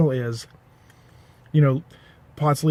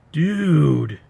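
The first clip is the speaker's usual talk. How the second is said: slow, dragged-out stoner surfer drawl; 3 semitones higher, much slower than usual. Retold or imitated. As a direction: stretch it like a baked dude in awe. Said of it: "that accent was".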